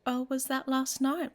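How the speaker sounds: teasing. Acted. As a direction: needle them playfully. Teasing about last night.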